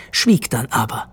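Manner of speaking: usual speaking voice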